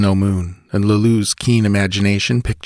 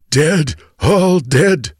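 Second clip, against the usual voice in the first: slow, raised, broken words; dying voice; 9 semitones higher, 4 dB louder, much slower than usual. Acted. Wounded bandit to chief, slow dying gasp.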